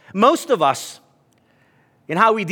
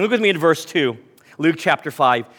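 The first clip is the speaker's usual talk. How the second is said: ordinary talk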